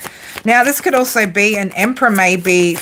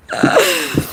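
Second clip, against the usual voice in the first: laughing; 10 semitones higher than usual. Real, not acted. Laughing at cheeky chat comments.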